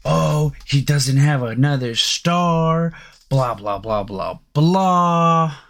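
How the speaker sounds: slow, dismissive mock voice with a sneering blah; mock whiny quote, trailing into blah blah; mocking the critics. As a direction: brush off the excuse as a tired cliché. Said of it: "what was the argument?".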